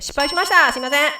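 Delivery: loud, high, laughing confession; laughing apology. Real, not acted. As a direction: own up to the flub, breaking into laughter. Laughing apology for failed high note.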